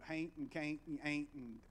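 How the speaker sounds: heavy Appalachian twang imitated; hill-country Appalachian vowels, played up; Appalachian accent. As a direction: imitate your own thick Appalachian accent. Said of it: "that's my heavy Appalachian accent".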